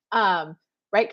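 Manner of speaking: normal talking voice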